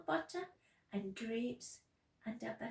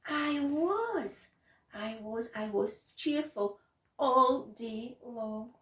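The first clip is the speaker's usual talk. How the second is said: loud, emphatic cheerful boast; proud announcement; 8 dB louder, slower than usual. Acted. Child reporting a cheerful day, loud and proud. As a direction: announce it proudly, stretching the last words.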